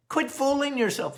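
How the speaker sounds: ordinary talk, nothing marked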